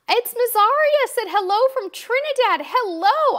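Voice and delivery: high, loud, bright hello; excited greeting. Real, not acted. Host calls out hello to a viewer from Trinidad, high and loud. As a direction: sing out a big welcome.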